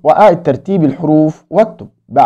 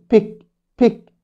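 بيك said higher and softer; high modelled repeat; 5 semitones higher, 5 dB quieter than usual. Shown as a demonstration. Teacher modelling pick for kids to repeat. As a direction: call and repeat, lighter. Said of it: "قول معايا".

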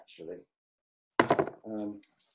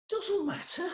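flat, high, deadpan Scouse retort; a hint of a Liverpool drummer shutting it down; Scouse accent; slower than usual. Drummer mocks the hat question, lightly Scouse deadpan. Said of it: "in broadest Scouse accent".

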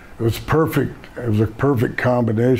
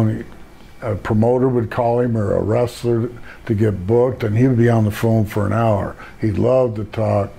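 ordinary delivery

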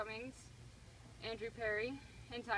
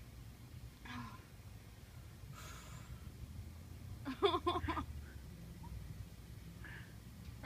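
nervous laugh; 6 semitones higher than usual. Real, not acted. Long nervous laugh waiting.

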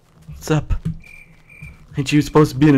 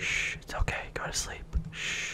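hushed, shushing whisper; creepy whisper; 9 semitones lower, 19 dB quieter than usual. Pretend. Player shushing his friend to sleep, creepy whisper. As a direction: whisper a creepy lullaby-like hush. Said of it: "That was creepy".

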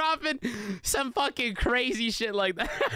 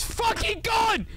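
high, panicked shout; being chased; 11 semitones higher, slower than usual. Real, not acted. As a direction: yell it in panic, fleeing.

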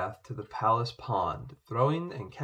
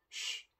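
very soft hissed shh; a nervous shush at dinner; hushing shh; 12 dB quieter than usual. A narrator's reading. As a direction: hush them urgently. Hushing the table.

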